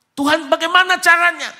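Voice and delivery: high, loud desperate question; anguished cry. Retold or imitated. Mother asking God how, in a loud high cry. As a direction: cry it up to heaven.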